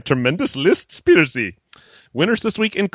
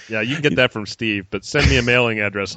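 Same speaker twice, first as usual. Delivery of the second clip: ordinary talk, nothing marked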